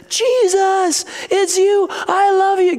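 put-on high, excited child's cry; overjoyed little kid. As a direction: act out a kid thrilled to see his hero. Dream kid greets Jesus joyfully.

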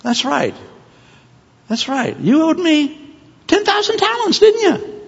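very high-pitched, pointed questioning; indignant master. Acted. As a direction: master confronting his debtor in a high put-on voice. Acted master confronts forgiven servant.

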